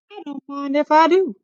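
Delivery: help yourself with cheeky glee; high cheeky girl; Goldilocks helping herself. A narrator's reading. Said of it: "said Goldilocks".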